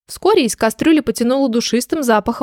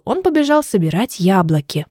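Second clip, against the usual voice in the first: ordinary delivery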